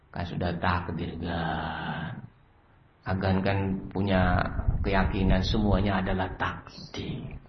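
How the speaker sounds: low, soft placating servant; small, subdued, apologetic voice; meek excuse. Acted. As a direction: plead fate timidly to an angry boss. Servant excuses himself to angry boss.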